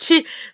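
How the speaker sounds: short bright burst of laughter; a quick real laugh. Real, not acted. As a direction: laugh at the joke. Laughs at her own quote.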